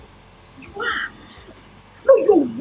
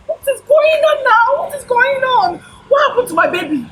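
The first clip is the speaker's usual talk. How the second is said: frantic cry, much higher and louder; panicked shout; 9 semitones higher, 9 dB louder than usual. Acted. Confused woman cries out about her lost baby. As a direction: demand answers in alarm.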